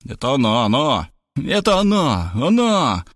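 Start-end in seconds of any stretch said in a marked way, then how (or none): 1.4-3.0 frightened cry. A narrator's reading. Friend panics at the doorbell, high frightened cry. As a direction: squeal it out in alarm. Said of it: "испугался Заславский".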